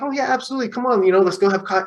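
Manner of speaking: high-pitched, lightly acted eager yes; eager agreement. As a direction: imitate a friend readily saying yes. Acting out friend's eager yes.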